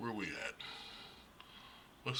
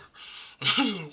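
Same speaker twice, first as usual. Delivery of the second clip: loud laugh; 12 semitones higher, 8 dB louder than usual. Real, not acted. Laughing at friends' reaction.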